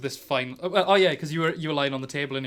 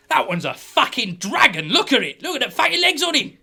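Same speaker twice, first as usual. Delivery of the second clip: loud, climbing ever higher; incredulous outburst; 9 semitones higher, 8 dB louder than usual. Acted. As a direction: exclaim in mounting alarm at a monster. Sergeant gawps at monstrous fly.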